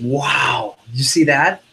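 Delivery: wowed, drawn-out amazement; excited. Shown as a demonstration. Coach shows the right reaction to cutting, excited. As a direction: sell the amazement to the customer. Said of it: "you gotta get them excited".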